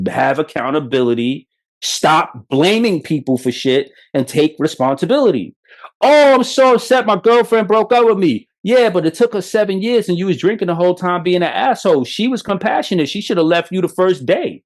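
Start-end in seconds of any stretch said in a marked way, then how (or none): 6.0-8.5 high, louder sarcastic whine; pitched-up mock complaint; mocking whiny excuse. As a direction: mimic a self-pitying drunk with scorn.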